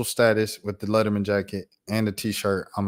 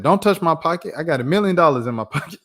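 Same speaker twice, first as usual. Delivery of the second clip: higher, louder imitated protest; mock warning; 6 semitones higher, 4 dB louder than usual. Dad warning a fan off his pocket, raised mock protest. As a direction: act out a jokey indignant refusal.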